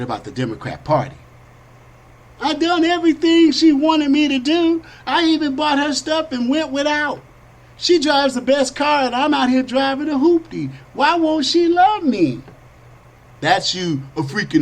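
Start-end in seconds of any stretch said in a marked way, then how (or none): 2.4-10.7 high pleading whine; mock whining voter. Jilted voter whining high about his woman. 10.9-12.4 high, slow pitiful whine; mock whining. Jilted voter whining high and slow.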